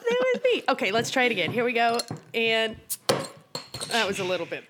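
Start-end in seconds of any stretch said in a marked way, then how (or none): 0.1-0.6 put-on high, far-off spooky child voice; eerie ghost child.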